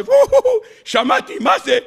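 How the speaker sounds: high amazed wow; surprised listener. Retold or imitated. Townsman answering his friend Moshe, high amazed gasp. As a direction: gasp in wonder at the distant cry.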